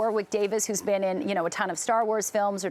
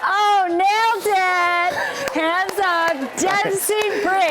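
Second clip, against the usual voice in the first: much higher, louder, slow delighted laughing shout; excited laughing cheer; 10 semitones higher, 6 dB louder, much slower than usual. Real, not acted. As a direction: cheer him on through laughter. Host thrilled by guest's pickup line.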